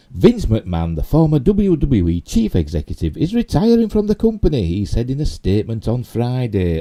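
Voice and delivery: loud, high-pitched headline read; projected news-story reading. Real, not acted. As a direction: read the article out emphatically, pitch swinging high. Reads news article aloud.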